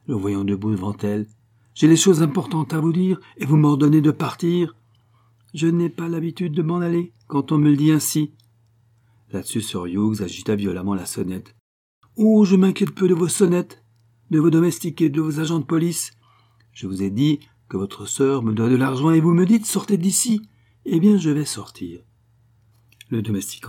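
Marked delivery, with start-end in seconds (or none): 1.7-8.3 high, loud, outraged woman; affronted, forceful protest; indignant Madame Gordeloup. A narrator's reading. 12.2-22.0 high, indignant woman's voice; excitable, defiant outburst; defiant Madame Gordeloup. A narrator's reading.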